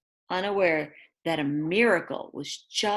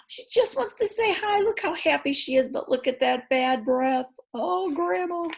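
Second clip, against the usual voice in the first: high sing-song excitement over the dog; high excited; 9 semitones higher than usual. Acted. Reader telling kids about Hallie greeting Grandma, high and excited. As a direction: squeal along with the picture.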